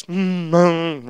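low closed-mouth groaning; demonstrated groan. Shown as a demonstration. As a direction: groan wordlessly, twice.

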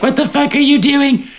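high, exasperated squeal as Summer; mock Summer. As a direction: shriek at Cohen in mock disbelief. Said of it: "And she's like".

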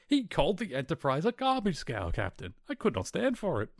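high-pitched retold outburst of wounded pride; indignant outburst. Scottish engineer finally cracks before his captain, indignant outburst. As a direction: blurt it out as if unable to hold it in. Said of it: "breaks Scotty can't hold it anymore".

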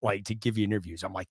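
ordinary talk, nothing marked

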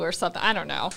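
ordinary talk, nothing marked

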